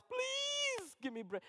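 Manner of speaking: put-on very high, slow, crying child's plea; mock-crying little girl begging for breakfast; wailing child. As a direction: whimper like a begging toddler. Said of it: "And she cries".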